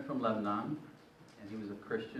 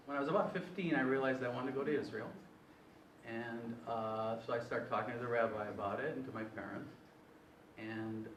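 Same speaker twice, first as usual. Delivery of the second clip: normal talking voice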